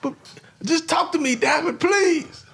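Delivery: Marvin's hammed-up begging; desperate pleading man. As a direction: beg her like a pathetic admirer.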